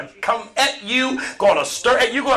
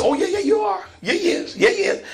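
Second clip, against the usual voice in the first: teasing insistence. Acted. Lady insisting, acted.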